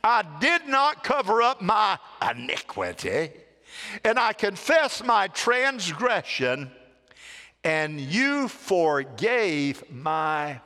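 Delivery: slow, solemn reading of a psalm; scripture reading. Acted. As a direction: read it slow and weighty. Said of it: "Here we go".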